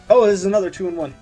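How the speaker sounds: ordinary delivery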